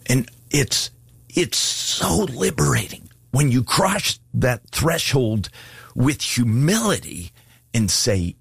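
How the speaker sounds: ordinary talk, nothing marked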